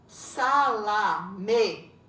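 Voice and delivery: "Salame" slow and loud, Sa - la - me; split into syllables. Shown as a demonstration. Teacher showing separated syllables, "Salame" drawn out as Sa - la - me. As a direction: give each beat of "Salame" its own space. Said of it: "estão separadas as sílabas".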